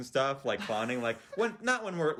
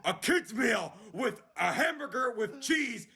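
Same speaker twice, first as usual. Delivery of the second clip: slow, much higher mock yell, breaking into laughter; mock shout; 7 semitones higher, slower than usual. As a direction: drag out the order like a fed-up customer. Customer yelling the whole order.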